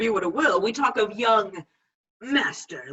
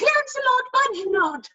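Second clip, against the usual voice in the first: much higher pleading conscience voice; 4 dB louder than usual. Retold or imitated. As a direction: warn him in a piping little voice. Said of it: "my conscience says".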